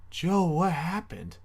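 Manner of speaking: high, slow, concerned brother; brother's question. Retold or imitated. Big brother finding his crying sibling, slow and worried. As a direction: ask it slowly and worried. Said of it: "He's like".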